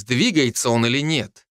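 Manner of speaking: plain reading voice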